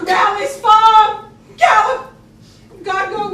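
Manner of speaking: high, loud, drawn-out shout; shouted send-off. Acted. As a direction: call after the galloping horse. Bet shouting after the rider.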